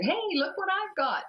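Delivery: high, excited call to get attention; retold excited "look at this"; excited showing off. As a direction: present a surprise in a high-pitched voice. Excited reveal to brother in dream.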